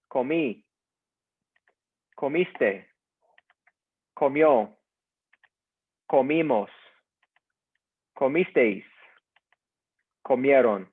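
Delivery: each form of Comer said alone and clearly, a pause after each, for the class to repeat; slow model pronunciation. Shown as a demonstration. Teacher drills Comer forms aloud for the class, slow and clear. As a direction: model each word slowly for students to echo. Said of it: "repeat after me".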